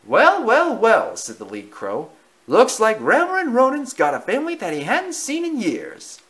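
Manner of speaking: loud, high cawing crow; brash crow, loud and sassy. Acted. The lead crow speaking.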